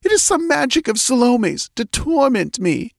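voix haute de reine désespérée, au bord des larmes; elle n'y croit pas, la voix aiguë et brisée. A narrator's reading. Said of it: "tears welled into her eyes".

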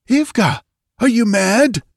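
high, sharp startled soldier voice; shocked to find her here; startled young soldier. Acted. Valerius startled by Ivga.

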